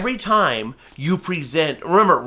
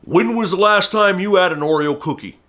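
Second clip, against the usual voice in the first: a lightly voiced billboard question, a little higher; 4 semitones higher, 3 dB louder than usual. A falconry teacher reading a billboard ad aloud, lightly voiced. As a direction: read the ad like a teasing billboard. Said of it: "it says".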